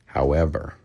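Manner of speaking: soft, tender and calm; gentle breakup demo. Shown as a demonstration. Coaching young men, calm tender breakup line. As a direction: say it gently, letting her down easy. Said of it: "Do it just like this".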